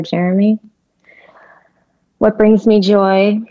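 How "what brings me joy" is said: slow and higher, touched; moved, slow wonder. Real, not acted. Guest touched by host's question, slow and moved.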